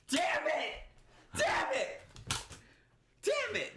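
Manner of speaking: high, exasperated outburst; pissed off. Real, not acted. Podcaster mixing up fighters' names, high exasperated outburst. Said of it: "I'm so pissed off right now".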